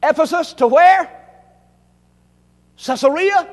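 loud call-and-response. Real, not acted. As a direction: shout the question to the crowd. Preacher leads call-and-response geography.

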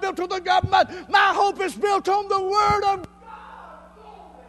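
slow, shouted preacher's opening line; preaching at full force. Acted. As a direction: belt it out to the congregation, slow and high.

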